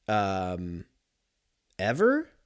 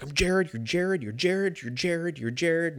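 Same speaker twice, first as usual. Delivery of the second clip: higher, chanted pep talk; hyping himself up; 7 semitones higher than usual. Retold or imitated. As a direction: pump yourself up, repeating the fake name. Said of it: "hyping myself up in the car".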